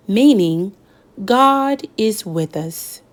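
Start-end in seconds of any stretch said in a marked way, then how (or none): none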